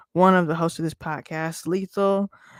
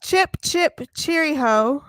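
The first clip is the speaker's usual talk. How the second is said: high, drawn-out mock British greeting; hammed-up British cheerio, slow and high; 8 semitones higher, slower than usual. Host greeting listeners after London game, mock British.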